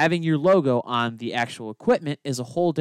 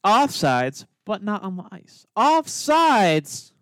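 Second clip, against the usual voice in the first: dramatic reality show hype; 6 semitones higher, slower than usual. Pretend. Teasing rule reveal in stages.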